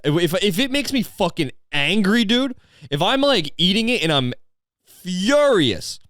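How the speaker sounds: high-pitched, worked-up mock outrage; hammed-up fury. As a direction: play furious, pitch leaping up on the key words. Plays up being furious at food.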